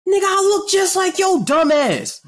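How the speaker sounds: high, slow, loud cocky boast; older self bragging. Pretend. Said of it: "talking to my Duncan self".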